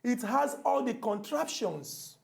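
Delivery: very slow, deliberate oration; showy formal English. Shown as a demonstration. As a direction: draw out each word as grand English. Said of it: "I go speak English".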